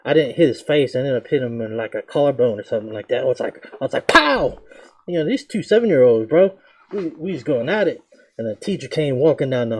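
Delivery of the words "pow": punch sound. Retold or imitated.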